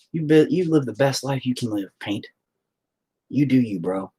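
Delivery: ordinary talk, nothing marked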